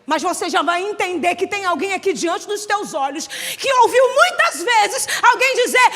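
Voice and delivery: ordinary talk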